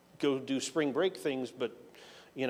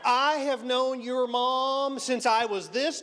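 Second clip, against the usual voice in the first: put-on slow, much higher fond old-timer voice; old family friend; 9 semitones higher, 3 dB louder, slower than usual. Minister telling the student he knew his mother, slow and high. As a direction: draw it out like a delighted old man.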